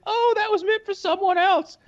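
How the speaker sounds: mock girly excuse. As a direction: play the coy girl who pretends it was a mistake. Imitating the woman's excuse.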